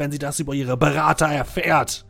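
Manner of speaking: loud, high furious accusation; sputtering foiled villain. Acted. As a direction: rage and sputter at the murderers. Guard master accuses the party.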